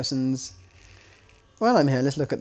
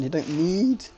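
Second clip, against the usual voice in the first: voice crack; 4 semitones higher, slower than usual. Real, not acted. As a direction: voice cracks upward mid-phrase. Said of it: "sorry, that was a very voice crack here".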